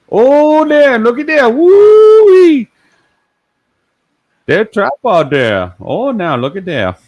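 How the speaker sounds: very high, loud Cajun exclaiming; hollering with peanut butter in the mouth; Cajun impression. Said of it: "talk like you got the peanut butter in your mouth".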